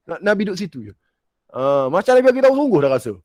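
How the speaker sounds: high-pitched, emotional wonder; awed realisation. Retold or imitated. Preacher reliving feeling the Prophet close by, high emotional wonder. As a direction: blurt it out, overwhelmed by nearness. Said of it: "saya dapat rasai".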